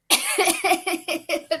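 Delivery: a real laugh breaking the reading; cracking up mid-sentence; burst of laughter. Real, not acted. Reader laughing at her own line, real laugh.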